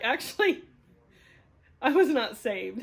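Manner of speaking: loud, emphatic contradiction; indignant retort. Retold or imitated. Woman retelling her answer to God, indignant retort. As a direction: push back firmly and loudly.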